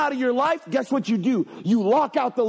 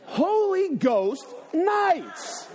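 Holy Ghost nights drawn out very slowly, pitched up with a laugh; higher, laughing, stretched-out repeat; slow mocking repeat; 7 semitones higher, much slower than usual. Shown as a demonstration. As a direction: echo their words back slowly, amused. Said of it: "Listen to what you just said".

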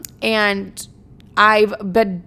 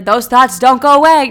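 loud, raised joking outburst; mock yelled warning; 4 semitones higher, 9 dB louder than usual. Telling viewers about recovery, jokingly yelled. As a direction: belt it out as a joke.

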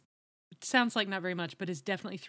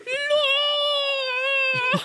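long, high, loud northern British mock-scream; 10 dB louder than usual. Shown as a demonstration. Host demonstrates a scream in northern British accent, high and drawn out. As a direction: stretch out a northern British shriek. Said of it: "scream in a northern British accent".

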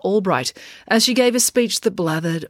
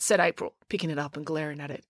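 plain reading voice

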